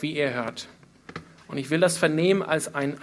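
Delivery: normal talking voice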